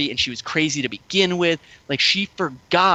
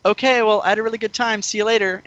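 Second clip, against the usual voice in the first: higher-pitched mock chirpy sign-off; breezy date goodbye; 7 semitones higher, faster than usual. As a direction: imitate the date breezily signing off. Retold date's breezy goodbye.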